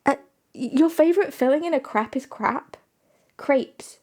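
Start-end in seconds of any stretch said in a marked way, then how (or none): 3.4-4.0 "Crepes" with an ay vowel, like "crayps"; long A pronunciation. Shown as a demonstration. Streamer trying out the word for chat, "Crepes" with a long A. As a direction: say "Crepes" to rhyme with "grapes". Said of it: "It's with an A sound?".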